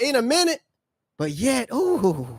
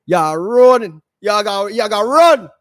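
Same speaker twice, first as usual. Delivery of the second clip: mock Jamaican accent; 5 dB louder than usual. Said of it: "hear him talking Jamaican man with the accent".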